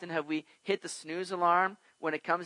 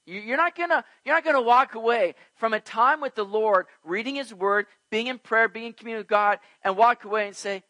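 higher, louder, building exhortation; rising preaching; 6 semitones higher, 7 dB louder than usual. Acted. Preacher urging the church to pray, building force. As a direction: drive it home to the congregation.

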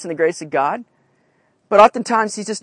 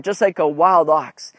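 ordinary delivery

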